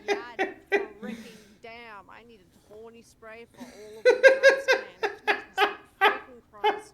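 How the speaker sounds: cracking up at the chaos, slow on Jesus; laughing exasperation. Real, not acted. Streamer to chat about rowdy friends, laughing loudly.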